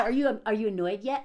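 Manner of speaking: usual speaking voice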